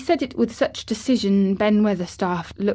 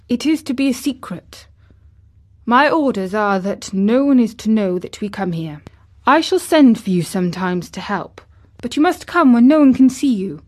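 put-on imperious boy giving orders; louder, emphatic young master; Colin; 3 semitones higher, 7 dB louder than usual. A narrator's reading. Colin orders the gardener to keep secret, commanding.

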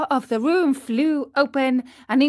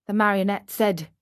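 plain reading voice

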